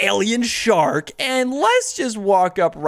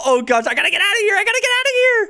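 rising panic; 9 semitones higher, faster than usual. Acted. Low health, fleeing.

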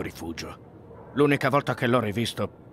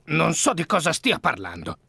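louder, higher, defensive protest; indignant denial; 6 semitones higher, 6 dB louder than usual. Acted. Suspect dismissing Holmes's questions about the letters, indignant. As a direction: brush off the accuser with rising irritation.

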